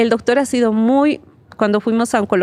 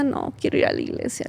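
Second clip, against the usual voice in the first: whispered reply; 3 semitones higher, 9 dB quieter, faster than usual. Retold or imitated. Quiet reply to her husband.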